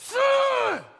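sudden very high, loud yelp; pained yelp. Retold or imitated. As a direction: yelp out in pain instead of cussing. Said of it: "I said, just".

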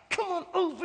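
high, held-in strained voice; pulling you back. Acted. The Spirit pulls a wanderer back, high strained coaxing. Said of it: "the Spirit's gonna pull you back".

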